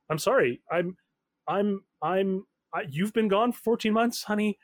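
stammer, then insist as an upset mother; a lightly acted flustered mom; stunned mother. Plays the mother on phone.

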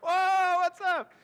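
high, loud surprised exclamation; surprised whoa. Real, not acted. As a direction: react with a startled high whoa. Reacting to laughter in room.

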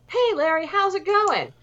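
high, lifted mock greeting to the painter; cheery greeting. Imitating Liza greeting the house painter, high and chirpy. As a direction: act out an over-friendly hello, pitched well up. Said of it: "is like".

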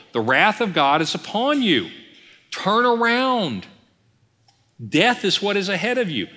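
street preacher. Acted. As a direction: call out a warning like a sidewalk zealot. Mimics fired-up street preachers warning passers-by.

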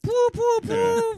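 very high squeaky poo noises; tiny high-pitched mock voice; silly squeak. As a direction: squeak it out like a toy.

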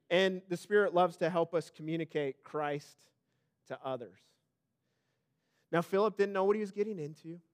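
ordinary delivery

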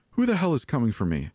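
high, fast, frightened demand; alarmed. A narrator's reading. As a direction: fire back the question in rising panic. Terrified woman hiding from an intruder.

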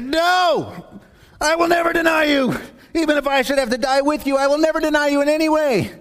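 high, loud insistent protest; Peter protesting. Acted. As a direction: Peter swearing he'll never deny Jesus.

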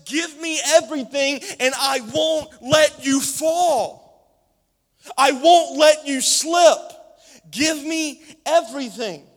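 high, loud, slow promise; voice of God. Acted. Preacher voices God promising not to let them fall. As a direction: proclaim God's promise with power. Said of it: "He says".

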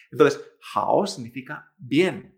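ordinary talk

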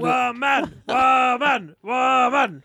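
mock shout. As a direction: bark it like scolding.

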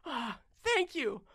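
high-pitched relieved gnome; tiny gnome voice, starting slowly; little gnome. Acted. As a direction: peep it out in a small high voice. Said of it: "he's like".

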